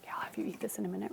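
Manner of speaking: hushed quick aside; whisper. Real, not acted. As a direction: whisper it so the class can't hear. Aside to a child.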